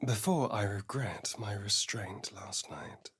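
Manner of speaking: soft whispered aside; hushed whisper. Acted. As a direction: breathe it out under your breath.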